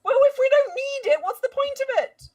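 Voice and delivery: high-pitched, loud, exasperated comeback; exasperated retort. Acted. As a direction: argue back in indignant protest. In-character quarrel over the tripwire.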